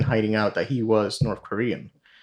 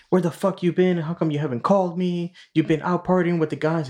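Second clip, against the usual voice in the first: pitched up, accusing, mock-nagging wife; angry wife on the phone; 7 semitones higher than usual. Wife scolding her husband over the phone, pitched-up mock anger. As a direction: chew him out like a suspicious wife.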